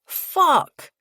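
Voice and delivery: high, loud exclamation falling away; sudden loud outburst of dismay; dismayed curse. A narrator's reading. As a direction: curse as trouble walks in. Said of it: "Drew and Carlos say in unison".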